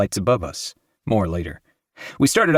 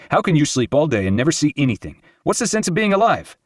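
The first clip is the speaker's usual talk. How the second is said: exasperated scolding; 7 semitones higher than usual. A narrator's reading. Irwin scolds friend.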